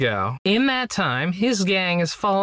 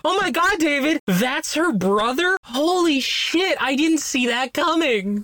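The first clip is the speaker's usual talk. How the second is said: higher, hammed-up astonishment; mock surprise; 8 semitones higher than usual. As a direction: overplay the shock sarcastically. Sarcastic shock at twist.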